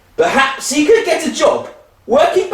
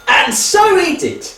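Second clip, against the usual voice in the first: ordinary talk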